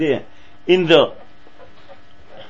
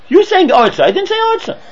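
indignant disbelief; 12 semitones higher, 5 dB louder than usual. Retold or imitated. Acted disbelief dialogue.

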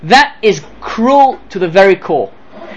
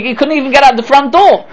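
usual speaking voice

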